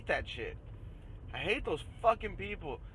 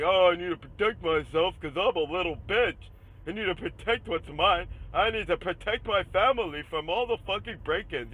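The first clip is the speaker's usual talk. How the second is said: whiny, louder mock voice of a scared gun owner; 2 semitones higher, 6 dB louder than usual. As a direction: sneer as you play the coward. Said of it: "running around with a fucking gun like".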